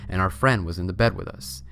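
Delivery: usual speaking voice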